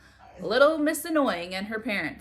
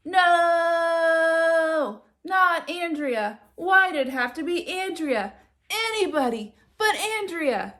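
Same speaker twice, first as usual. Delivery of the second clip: high, loud desperate wail; anguished protest; 7 semitones higher, 6 dB louder, slower than usual. Acted. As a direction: cry out like it's the end of the world. Kid protests unwanted housemate.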